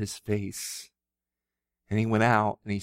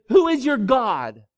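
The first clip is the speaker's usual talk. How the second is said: loud, higher, slow demand; prophet's loud challenge; 13 semitones higher, 10 dB louder, slower than usual. Acted. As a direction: hurl the question at the people. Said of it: "and said".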